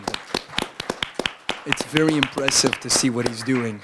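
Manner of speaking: ordinary talk, nothing marked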